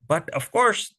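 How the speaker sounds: plain reading voice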